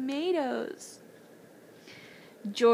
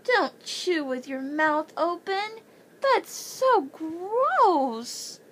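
high, slow and grossed out; drawn-out disgusted whine; disgusted moan; 7 semitones higher, 4 dB louder, much slower than usual. A narrator's reading. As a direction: whine it like you can't bear to look. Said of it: "Mandy Banks moaned".

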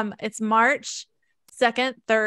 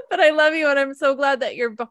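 crying through the words, louder; emotional, on the edge of crying; tearful; 4 semitones higher, 6 dB louder than usual. Real, not acted. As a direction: voice breaking with real tears. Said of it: "So I'm getting emotional".

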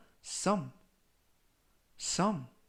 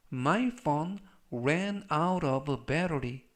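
very slow, measured words; slow model reading; much slower than usual. Shown as a demonstration. Teacher models the phone battery sentence for learners, slow model reading. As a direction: give every word time, as for beginners.

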